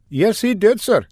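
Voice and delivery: insist, respectfully but urgently; young lieutenant correcting his superiors; eager lieutenant. Acted.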